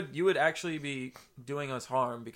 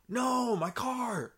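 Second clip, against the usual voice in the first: gasp, then a high wail; dismayed realisation; 9 semitones higher, much slower than usual. Real, not acted. Suddenly remembers cheese in the car.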